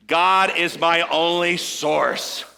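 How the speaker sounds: slow build landing hard on "source"; stress on source. Shown as a demonstration. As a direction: save the weight for the final word "source". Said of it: "emphasize a different word".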